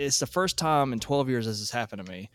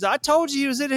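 imitated high, loud scolding; quarrelling; 13 semitones higher, 5 dB louder, faster than usual. Wife to husband in their quarrel, high scolding. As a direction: play the wife having it out with him.

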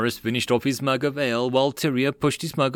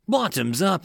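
higher, lightly acted group cheer; cheered toast; 8 semitones higher than usual. A narrator's reading. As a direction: raise the toast with a mild cheer. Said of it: "the men cheered".

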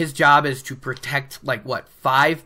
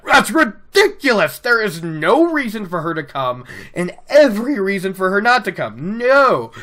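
hammed-up outraged protest, pitched up; acted anger; 4 semitones higher than usual. Lan arguing that she must not come, acted anger. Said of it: "he gets all angry".

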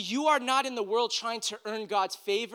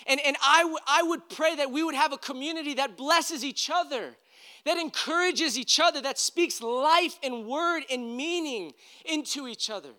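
higher and louder, earnest appeal; fervent preaching prayer; 7 semitones higher, 4 dB louder than usual. Acted. Pastor praying over his congregation, fervent and raised. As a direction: plead for the church like a prayer.

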